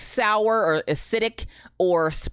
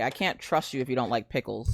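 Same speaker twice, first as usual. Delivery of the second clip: ordinary talk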